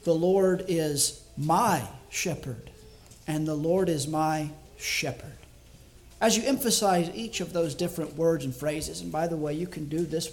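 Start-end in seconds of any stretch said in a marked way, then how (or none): 0.0-2.7 slow down and lean hard on "my"; "my" set apart by pauses as the key word; stress on MY. Shown as a demonstration. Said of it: "each time emphasize a different word". 3.5-5.3 "shepherd" separated as the final, weighted word; stress on SHEPHERD. Shown as a demonstration. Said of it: "each time emphasize a different word".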